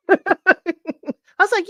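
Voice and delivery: laughing through the words, slowed. Real, not acted. Teasing her husband about his old hair, laughing. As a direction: giggle out the lead-in.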